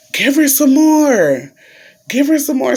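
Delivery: high-pitched imitation of a doctor's order; doctor giving orders.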